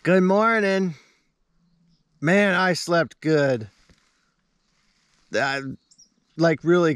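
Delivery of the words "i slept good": ordinary talk, nothing marked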